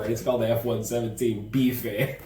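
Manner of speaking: laughing through the words; can't hold back the giggle. Real, not acted. Telling viewers about the bomber bar, laughing through it.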